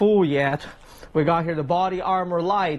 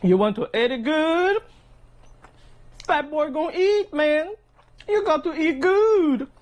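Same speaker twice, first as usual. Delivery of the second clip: hype voice; 9 semitones higher than usual. Retold or imitated. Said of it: "I always tell my subscribers".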